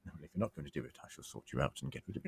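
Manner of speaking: hushed, low creepy threat; creepy, slimy menace. Acted. Villain threatening a woman in a dank room, creepy. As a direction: sink low and quiet, quietly menacing. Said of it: "that sort of creepy, like".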